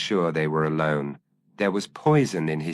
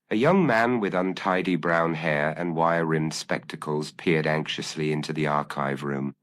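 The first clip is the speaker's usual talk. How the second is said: usual speaking voice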